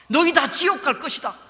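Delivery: thundering sentence of hell; furious verdict. Acted. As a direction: pronounce doom on them. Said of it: "이렇게 강력한 표현을 하십니다".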